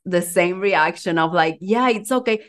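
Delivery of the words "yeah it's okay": ordinary talk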